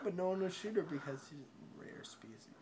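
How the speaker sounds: ordinary delivery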